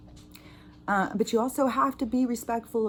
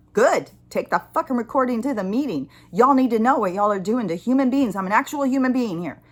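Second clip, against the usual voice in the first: loud, higher, indignant retold rant; angry defiance; 3 semitones higher, 6 dB louder than usual. Told the call is recorded, caller rants back angrily. Retells blowing up at agent.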